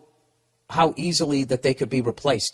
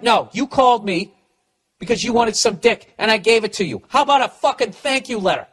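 loud, higher, indignant rant at her; angry comeback; 5 semitones higher, 3 dB louder than usual. Pretend. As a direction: tell her off, full of outrage. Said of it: "you really should have come at her like that".

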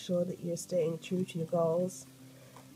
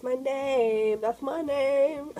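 high, loud, drawn-out glee; gleeful sing-out on spotting it; excited delight; 8 semitones higher, 6 dB louder, much slower than usual. Real, not acted. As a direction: stretch it out, rising in delight. Spots own name on the cover.

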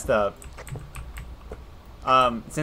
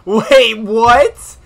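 sharp loud high "wait, what?"; startled and loud; surprised; 8 semitones higher, 14 dB louder than usual. Real, not acted. Sudden surprised outburst.

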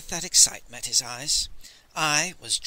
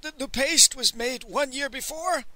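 high stammering question; aghast, pitch well raised; gasped alarm; 10 semitones higher than usual. A narrator's reading. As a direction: ask in mild shock, stumbling at the start. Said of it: "he gasped".

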